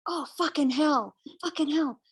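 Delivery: high, fed-up mock groan at the bugs; exasperated cursing. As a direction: throw the swearing up high in disgust. Cursing mosquitoes in the tent.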